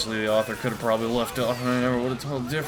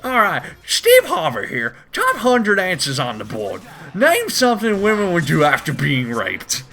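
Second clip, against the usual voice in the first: lightly voiced game show host; 4 semitones higher, 6 dB louder than usual. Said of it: "Steve Harvey's like".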